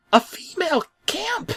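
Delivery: very high, small toy-like voice; bulldozer voice. Acted. A bulldozer repeating Bob, in a very high squeaky voice. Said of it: "one of the bulldozers said".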